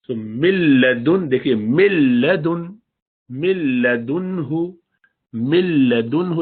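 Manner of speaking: مل لدن with the nun dropped straight into lam; slow doubled lam, nothing through the nose; no ghunna, slow. Shown as a demonstration. Teacher demonstrating idgham without ghunna, slowly. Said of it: "اس میں نون کی کوئی آواز ہی نہیں ہے".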